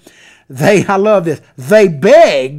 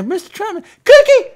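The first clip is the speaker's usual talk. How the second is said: high squeaky begging voice; mock begging lapdog; 13 semitones higher, 4 dB louder than usual. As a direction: beg like a little lapdog, pitched way up. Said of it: "He's going like".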